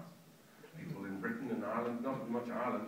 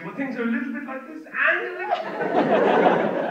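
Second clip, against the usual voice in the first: high, slow, dithering voice; mock liberal fence-sitter; wishy-washy liberal; 13 semitones higher, 16 dB louder than usual. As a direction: hedge everything, laughing. Mocking liberal people.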